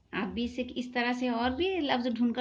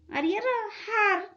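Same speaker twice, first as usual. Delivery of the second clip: हार sung out much higher; high teacher emphasis; 9 semitones higher, slower than usual. Shown as a demonstration. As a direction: lift the whole word high for the kids. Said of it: "ह का झटका देंगे हम". (बच्चों के लिए ऊँची आवाज़ में)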